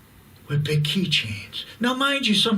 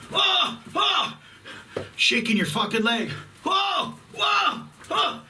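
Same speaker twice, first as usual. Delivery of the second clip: exasperated shouts; 14 semitones higher than usual. Real, not acted. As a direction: cry out at the jiggling leg in full annoyance. Exasperated at wife's leg shaking.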